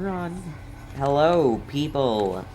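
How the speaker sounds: usual speaking voice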